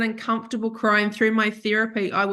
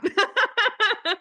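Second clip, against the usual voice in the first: long high-pitched peal of laughter; high giggling laugh; 11 semitones higher than usual. Real, not acted. Laughing at being an ugly crier, high giggle. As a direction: laugh in a high, rapid run.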